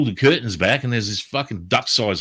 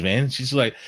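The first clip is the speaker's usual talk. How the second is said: ordinary talk, nothing marked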